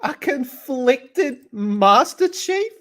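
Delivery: high, slow disbelieving repeat; incredulous. Real, not acted. Reacting to a headline about Master Chief, high and slow disbelief. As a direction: drag it out in disbelief.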